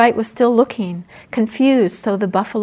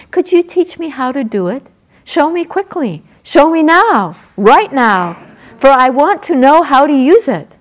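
loud, high and impatient; eager forest sprite; 4 semitones higher, 9 dB louder than usual. Acted. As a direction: demand it right now.

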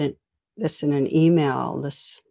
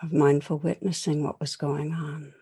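guided meditation. Acted. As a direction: guide listeners through calm reflection. Leads a reflection meditation.

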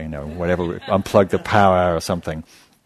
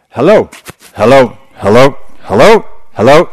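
loud, higher blaring hello through a speaker; loudspeaker announcement; 4 semitones higher, 12 dB louder than usual. Retold or imitated. Teacher mimicking the bus ticket loudspeaker, loud blaring call. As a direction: boom it out like a cheap roadside loudspeaker. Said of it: "the tape thing would go".

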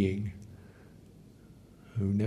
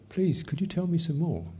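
higher, gently inviting; inviting, curious; 7 semitones higher than usual. Shown as a demonstration. Teacher demonstrating a welcoming voice to inner complaint, inviting. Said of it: "good attitude to have when you start complaining".

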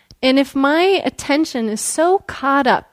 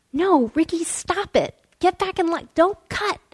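higher, child-like chorus of complaints, lightly voiced; kids scolding; 7 semitones higher, 3 dB quieter than usual. Children in line scolding Ricky, higher mock kid voices. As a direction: play the kids telling a classmate off. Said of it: "I can hear behind me the kids saying".

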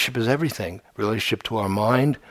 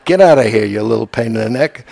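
louder, brusque W C Fields brush-off; a hint of grumpy comedian shooing a kid; gruff W C Fields; 7 dB louder than usual. Teacher imitating W C Fields shooing a child, lightly gruff. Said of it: "he'd say".

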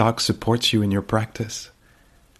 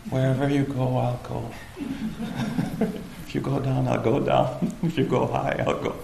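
higher-pitched, lightly hammed-up eager follower, breaking into laughs; mock-devoted, chuckling between phrases; playful tagging-along; 4 semitones higher than usual. Teacher voices someone following a thought wherever it goes, playful.

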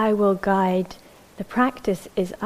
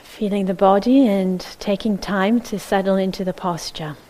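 calm, measured meditation guidance with long silences; unhurried, even voice leaving space between phrases; guided meditation. Acted. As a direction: settle listeners into the body, phrase by phrase.

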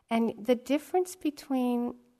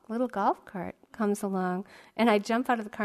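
usual speaking voice

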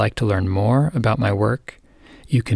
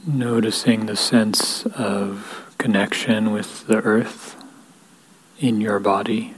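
guided meditation. Real, not acted. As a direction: lead them gently into stillness, unhurried, leaving space between phrases. Leads a guided equanimity meditation.

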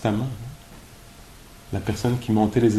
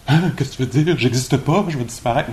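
lightly acted alarm, higher pitched; mock freak-out; 4 semitones higher, 4 dB louder than usual. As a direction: act out a rattled listener's startled questions. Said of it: "le monde freak un peu". (panique jouée, légère)

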